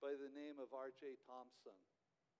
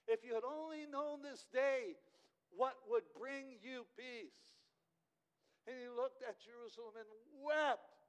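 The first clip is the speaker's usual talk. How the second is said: much higher and louder, pleading lament; preaching at full force; 6 semitones higher, 11 dB louder than usual. Acted. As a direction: cry it out over the lost city. Climax of the sermon.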